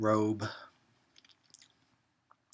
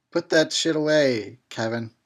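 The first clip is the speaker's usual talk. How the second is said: ordinary talk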